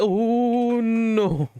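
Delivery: long high-pitched "oh no"; hammed-up drawn-out wail of dismay; mock wail. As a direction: stretch it high like a cartoon lament. Mock wail while playing game.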